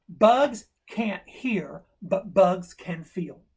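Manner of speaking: slow, spaced "bugs can't hear, but bugs can feel"; emphatic revelation. Retold or imitated. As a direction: punch each "bugs" and space the words out. Acted boy announcing his theory.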